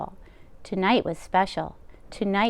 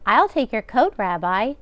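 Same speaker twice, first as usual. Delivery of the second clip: bustle like a kind deaf baba; old grandmother voice; 4 dB louder than usual. Acted. Baba voicing lines to Bear.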